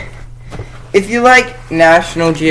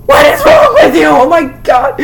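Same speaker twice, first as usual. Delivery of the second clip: much higher, loud disgusted shout; outraged shriek; 6 dB louder than usual. Pretend. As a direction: scream it in mock horror. Outraged at Barbie comic.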